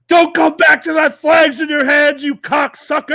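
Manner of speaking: high, loud parting yell over the rail; furious shout. Retold or imitated. Master chief yelling down at the kid in the water, furious. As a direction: bellow it down at the water.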